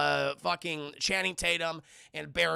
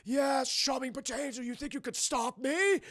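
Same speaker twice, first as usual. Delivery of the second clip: hammy villain; 9 semitones higher, 3 dB louder than usual. Retold or imitated. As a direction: proclaim it like a cartoon overlord. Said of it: "And he's like".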